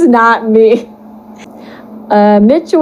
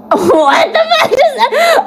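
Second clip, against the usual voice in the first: high squealing disbelief; shocked laughing shout; 14 semitones higher, slower than usual. Real, not acted. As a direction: shriek it out laughing. Shocked reading fanfic.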